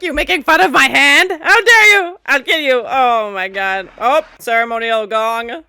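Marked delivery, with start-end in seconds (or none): 0.0-2.8 higher, louder mock outrage, fast; hammed-up threat over a hand joke. Voicing the offended villain, in mock outrage, louder. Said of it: "They're like".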